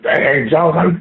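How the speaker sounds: normal talking voice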